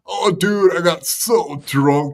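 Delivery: dumb party bro voice; frat bro. Retold or imitated. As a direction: brag like a high school frat boy. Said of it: "this dude sounds like a frickin' frat boy".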